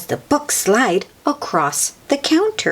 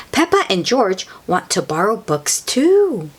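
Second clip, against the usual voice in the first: plain reading voice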